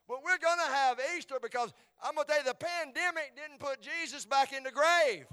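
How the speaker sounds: high, shouted pulpit proclamation; full-force preaching. Real, not acted. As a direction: build to a roaring Easter declaration.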